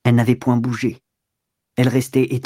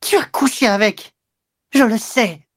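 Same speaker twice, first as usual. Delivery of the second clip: high-pitched accusing cry, little added volume; 9 semitones higher than usual. A narrator's reading. Mistress accusing her lover, crying out. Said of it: "Elle cria".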